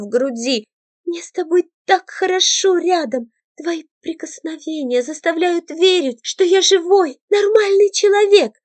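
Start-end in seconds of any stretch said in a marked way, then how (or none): 1.0-8.5 cling to him, moved; girl hugging him, voice swelling with feeling; emotional girl. A narrator's reading.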